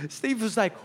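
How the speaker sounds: ordinary talk, nothing marked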